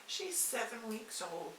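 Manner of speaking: ordinary delivery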